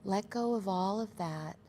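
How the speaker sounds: calm, unhurried meditation voice with long pauses; guided meditation. Acted. Meditation leader guiding the group into their breath, calm and measured. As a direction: ease them gently into each breath, leaving space between phrases.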